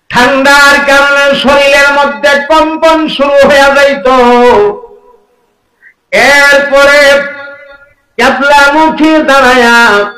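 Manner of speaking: high-pitched, impassioned sermon delivery; full-force preaching. Acted. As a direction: proclaim each phrase high to the crowd. (উঁচু সুরে আবেগী ওয়াজ)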